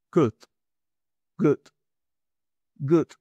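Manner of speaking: "good" with a very short u; short u demo. Shown as a demonstration. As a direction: clip the u in "good". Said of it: "Es una U muy corta". ("good" con una U muy corta)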